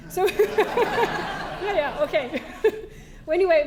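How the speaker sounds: laughing through the words. Real, not acted. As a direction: laugh it off and get back on track. Laughing at her own tangent.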